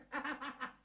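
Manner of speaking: ha-ha on command, pushed and showy; forced laugh. Acted. Urging his gloomy friend to laugh, forced laugh. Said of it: "اضحك".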